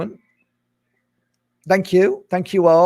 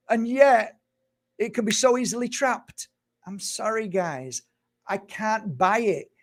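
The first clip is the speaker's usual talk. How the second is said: getting emotional; 6 semitones higher than usual. Real, not acted. To viewers, rejecting David Icke's light trap idea, emotional and high. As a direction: pitch jumps up high in exasperated disbelief. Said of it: "a bit emotional sometimes".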